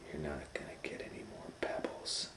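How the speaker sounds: soft whispered taunt; gloating whisper. A narrator's reading. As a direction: whisper it with spite. Stepmother gloating quietly.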